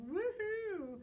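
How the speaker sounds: high, loud cheer; joyful whoop. Acted. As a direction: let out a thrilled whoop. Monster thankful to be alive.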